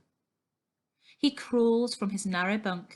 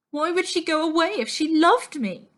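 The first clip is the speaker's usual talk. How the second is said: hurt outburst; 8 semitones higher, 7 dB louder than usual. A narrator's reading. Abandoned girl's hurt outburst.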